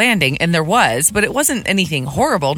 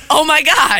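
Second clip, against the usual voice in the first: frightened gasp; 9 semitones higher, 5 dB louder than usual. Retold or imitated. Retelling her own fright.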